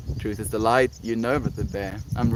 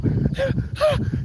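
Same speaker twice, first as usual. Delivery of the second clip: high mock gasp. Acted struggle.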